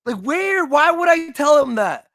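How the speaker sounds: high, loud disbelief; incredulous outburst. Real, not acted. Comedian protesting the story to his co-host, incredulous shout. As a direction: fire the question back in shock.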